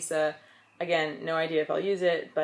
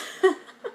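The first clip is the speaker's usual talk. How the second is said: short high giggle; real laugh; 13 semitones higher, 3 dB louder than usual. Real, not acted. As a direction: laugh at the rude word. Laughing at her own joke.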